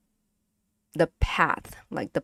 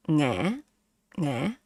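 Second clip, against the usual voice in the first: "nghe" easy, dipping up; relaxed question tone. Shown as a demonstration. Teacher comparing two marks, relaxed rising "nghe". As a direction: let "nghe" rise loosely like a question. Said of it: "relax, right? This, this question mark".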